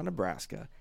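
usual speaking voice

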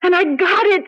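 bursting with the news of the lead role; thrilled. Acted. Thrilled about winning the part.

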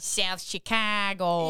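put-on drawn-out Australian accent. Co-host taking over the tourist's line, Australian accent. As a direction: slowly stretch it in an Australian accent. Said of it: "I can't do, you know, Emma, if you wanna".

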